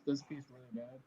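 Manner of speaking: muffled behind covered mic; mic blocked by hand; finger over the mic. Real, not acted. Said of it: "You're covering your mic".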